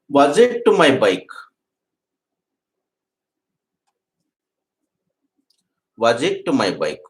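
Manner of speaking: word by word, very slowly; teacher modelling the question, slow and deliberate; slow model question. Shown as a demonstration. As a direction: dictate the question slowly to the class. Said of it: "interrogative sentence చేయండి".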